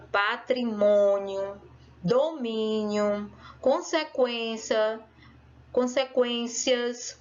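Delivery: slow, deliberate word-list reading; reading words aloud. Shown as a demonstration. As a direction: read each word out carefully, stressed syllable stretched. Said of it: "vai ler em voz alta".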